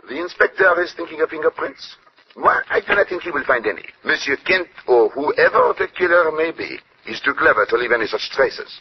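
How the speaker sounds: French-tinged English of a Belgian detective; courteous Belgian French accent. Acted.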